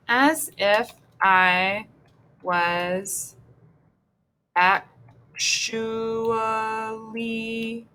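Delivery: slow, syllable-by-syllable dictation; drawn-out composing. Real, not acted. Writing the next poem line, slow and drawn out. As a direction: stretch the words out, pausing between them.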